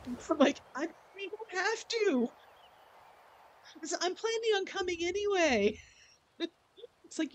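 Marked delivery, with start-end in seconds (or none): 0.7-5.8 high mock reply. Reassuring the Blizzard woman.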